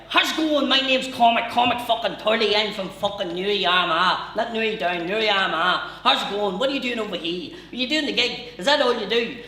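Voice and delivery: loud, high Newry taxi man; brash high Newry patter; Newry accent. Retold or imitated.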